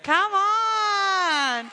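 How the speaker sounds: high, loud, long-held cry; rallying shout. Real, not acted. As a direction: whip up the congregation with a drawn-out shout. Preacher rallying congregation after testimony.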